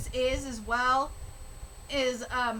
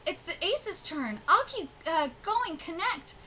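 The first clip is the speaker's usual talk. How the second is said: higher, earnest reading voice for the characters; reading manga aloud; 5 semitones higher than usual. Acted. Vlogger reading karuta manga dialogue to viewers, earnest and higher. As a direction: read each speech bubble with feeling.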